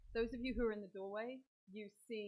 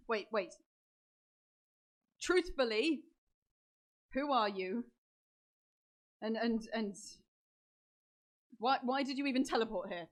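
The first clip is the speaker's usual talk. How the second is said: loud, halting, higher-pitched demands; suspicious interrogation; 4 semitones higher, 11 dB louder than usual. Acted. Game master's character interrogates the intruder, loud and suspicious. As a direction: press them for answers, stopping and restarting.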